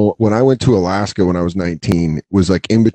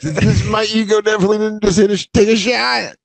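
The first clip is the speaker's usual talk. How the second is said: shallow breathing; 10 semitones higher than usual. Retold or imitated. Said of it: "that weird shallow breathing".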